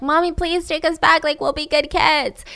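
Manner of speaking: high-pitched mock whining plea; pleading children. Children tugging at their mother, pleading. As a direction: imitate little kids begging, lightly tearful. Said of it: "they would cry".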